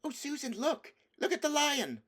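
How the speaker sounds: Lucy crying out to Susan, high and thrilled; excited call. A narrator's reading. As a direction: point and call out in delight. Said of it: "Lucy said".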